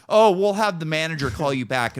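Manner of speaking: high, loud fake-polite rep; customer service voice. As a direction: placate him like a scripted rep.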